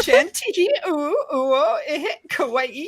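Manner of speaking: very high mock-anime cuteness; uwu voice. Hamming up the bit for a friend, high uwu voice. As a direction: squeal it in a cutesy uwu voice. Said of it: "hitting that ooh-woo voice".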